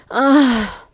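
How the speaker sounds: high, loud cry of "ah"; overwhelmed moan. A narrator's reading. Narrator overcome as the sensation intensifies, high loud moan.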